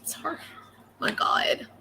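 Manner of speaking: real tearful apology, very soft and halting; crying. Real, not acted. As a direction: choke out the words through tears, long breaks between. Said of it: "for crying and acting a fool".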